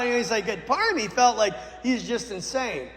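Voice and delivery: ordinary delivery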